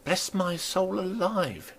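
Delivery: high, slow startled landlady; a landlady struck by a sudden thought; landlady exclaiming. Acted. Landlady suddenly remembering her kitchen, high and slow.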